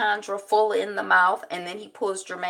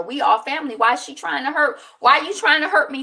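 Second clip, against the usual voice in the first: a rising, pleading plea, higher and louder; imitating an upset mother asking why her family hurts her; upset Diva D; 7 semitones higher, 5 dB louder, faster than usual. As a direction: voice her hurt and upset, pushing faster.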